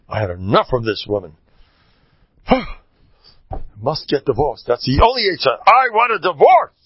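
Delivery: fed-up husband rising to a high, loud demand; acted exasperation, building. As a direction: start weary, build to an emphatic outburst.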